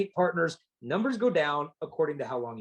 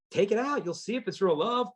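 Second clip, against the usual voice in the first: ordinary delivery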